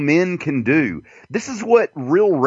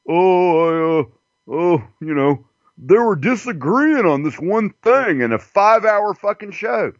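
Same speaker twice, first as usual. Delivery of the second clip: mocking commenters; 6 semitones higher than usual. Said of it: "It's a".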